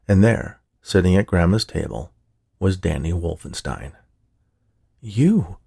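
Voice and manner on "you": accusingly. A narrator's reading. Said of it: "Ruby said accusingly".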